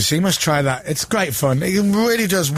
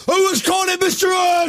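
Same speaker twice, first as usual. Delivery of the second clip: mock shout; 14 semitones higher than usual. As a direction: bark it like a stern official. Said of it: "gonna come in now and go".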